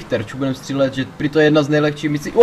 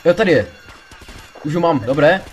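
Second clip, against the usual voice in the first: ordinary talk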